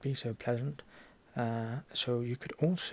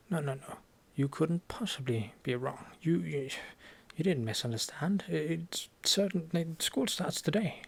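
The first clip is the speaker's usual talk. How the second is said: ordinary delivery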